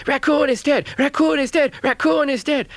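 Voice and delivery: high, loud squeaky shouts; little crayfish shouting the news, high and loud. A narrator's reading. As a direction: cry the news to everyone. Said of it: "he shouted loud".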